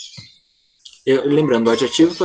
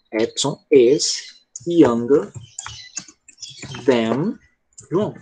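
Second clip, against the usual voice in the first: slow dictation; much slower than usual. Shown as a demonstration. Dictating while writing on board.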